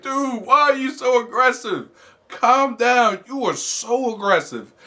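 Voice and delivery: playful disbelieving scold; mock scolding. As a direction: chide the stalker with mock disbelief.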